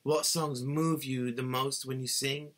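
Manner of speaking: ordinary talk, nothing marked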